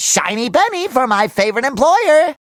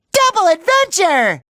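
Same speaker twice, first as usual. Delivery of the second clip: excited cheer; 7 semitones higher than usual. Acted. Excited game character cheer.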